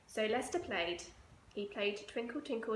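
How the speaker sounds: usual speaking voice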